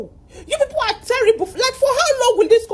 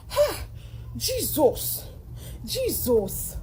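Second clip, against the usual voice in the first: soft, much lower, fading Jesus; despairing sigh; 5 semitones lower, 9 dB quieter, much slower than usual. Real, not acted. Student giving up after her score, whispering Jesus in despair. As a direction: sink into hopeless quiet.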